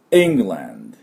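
England with the first syllable hit hard; England, weight on the opening E and a light land; stress on the E. Shown as a demonstration. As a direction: punch the E of England. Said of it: "最初にアクセントがありますから".

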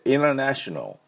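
international with the T swallowed, inna-nashonal; soft T international. Shown as a demonstration. Teacher showing how the T in international weakens. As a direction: let the T of international melt away. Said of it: "イントのとこはどうしても弱まって".

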